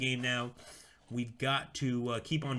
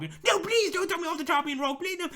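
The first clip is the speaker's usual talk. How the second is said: mock pleading; 4 dB louder than usual. Voicing the doomed consul begging.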